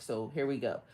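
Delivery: usual speaking voice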